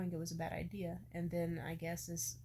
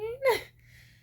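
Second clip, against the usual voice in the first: laugh; 13 dB louder than usual. Real, not acted. Laughs at her own quote.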